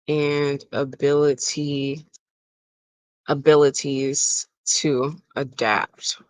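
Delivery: word by word, with long pauses; slow dictation. Real, not acted. Host composing a daily affirmation aloud, slow dictation. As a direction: compose it aloud slowly, stretching each word.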